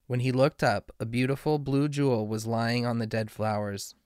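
ordinary delivery

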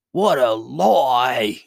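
loud, hugely drawn-out cry; slow, furious queenly bellow; enraged roar. A narrator's reading. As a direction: roar it in outrage, stretching each word. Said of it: "roared the queen in a rage".

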